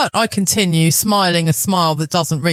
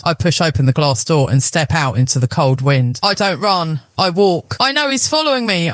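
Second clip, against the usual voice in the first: ordinary delivery, nothing marked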